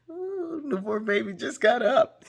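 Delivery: high, loud laughing joke; laughing tease. Acted. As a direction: laugh at your own sleepy face. Joking about just-woke look.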